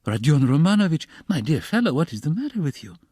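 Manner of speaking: high, alarmed appeal; anxious, fussing concern; frightened. A narrator's reading. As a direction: plead in rising alarm. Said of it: "apparently quite frightened".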